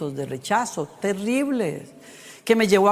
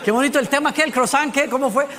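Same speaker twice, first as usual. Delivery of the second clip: distracted congregants; 4 semitones higher, faster than usual. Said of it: "Algunos estaban así como".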